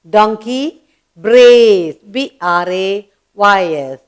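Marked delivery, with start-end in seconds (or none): 0.1-1.9 "brays" stretched long and loud; slow teaching call, long vowel on "brays"; drawn-out brays. Shown as a demonstration. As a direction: swell loudly on a slow "brays". Teacher repeats the donkey's sound word.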